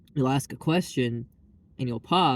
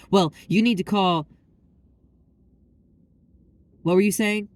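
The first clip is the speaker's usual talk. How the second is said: higher, louder snippy cut-off then fake interest; mock impatient staffer; 7 semitones higher, 6 dB louder than usual. Mocking the call staff who interrupt, higher and louder. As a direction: imitate the staffer talking over you.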